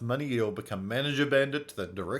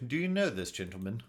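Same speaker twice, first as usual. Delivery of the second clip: normal talking voice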